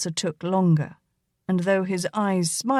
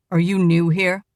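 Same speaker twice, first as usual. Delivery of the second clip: half-angry; 5 dB louder than usual. A narrator's reading. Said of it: "in a half-angry manner".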